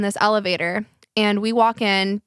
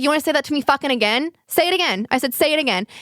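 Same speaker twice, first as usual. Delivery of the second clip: high, quick, heated challenge; confrontational challenge; 5 semitones higher, faster than usual. Retold or imitated. Teller challenges the woman who insulted her, retold confrontationally. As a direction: dare them to repeat it, acted out. Acted quarrel, daring her to repeat.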